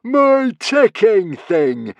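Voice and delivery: high, loud, drawn-out cry of joy; gleeful villain, every word stretched; delighted cry. Acted. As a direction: crow over a recovered treasure. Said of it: "cried Norbert delightedly".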